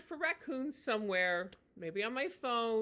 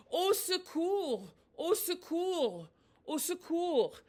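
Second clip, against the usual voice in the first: "Au secours" called out high and a bit louder, slowly; French cry for help, demonstrated; demo shout; 8 semitones higher, 5 dB louder, much slower than usual. Shown as a demonstration. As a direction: call "Au secours" for help, held out. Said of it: "If I shout".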